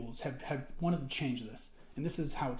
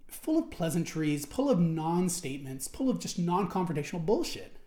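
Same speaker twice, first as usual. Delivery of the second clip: ordinary delivery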